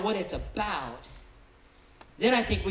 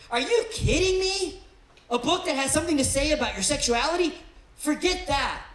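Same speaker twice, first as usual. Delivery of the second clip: mock scoffing; 8 semitones higher, 6 dB louder than usual. Preacher voicing a skeptic outside the church, loud scornful scoff. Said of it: "the big skeptic outside the church who looks in and goes".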